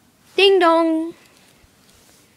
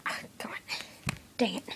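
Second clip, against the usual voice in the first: annoyed whisper; 5 semitones lower, 16 dB quieter, much faster than usual. Acted. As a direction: grumble it under your breath. Frustrated aside.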